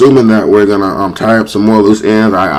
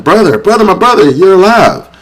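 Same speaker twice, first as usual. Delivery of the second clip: much higher-pitched mock-emotional outburst; retold tearful cry; 10 semitones higher than usual. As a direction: imitate an overcome brother's high cry at a reunion. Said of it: "they both start crying".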